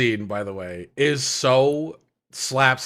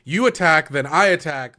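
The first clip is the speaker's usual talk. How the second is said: bossy insistence; 5 dB louder than usual. Retold or imitated. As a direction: lay down the turn order firmly. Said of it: "she's like".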